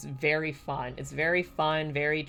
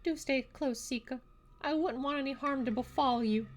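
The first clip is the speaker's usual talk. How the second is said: high, soft character voice; protective game character; 9 semitones higher, 4 dB quieter than usual. Acted. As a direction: voice it softly and high, with gentle concern.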